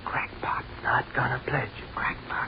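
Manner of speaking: soft, ghostly whispered jeer; faint echoing whisper in his head; whispered taunt. Acted. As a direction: hiss it like a voice in his mind. Taunting voices inside his head.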